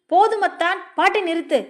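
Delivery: high-pitched plea to stop; protesting. A narrator's reading. Woman stopping her cousin's song, high protest. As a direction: cut in, pitched well up. She cuts off his singing.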